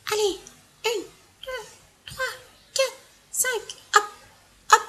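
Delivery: high, soft strained counting; strained climbing effort. Acted. Third elephant climbing the tree, straining quietly. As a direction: grunt it out while climbing.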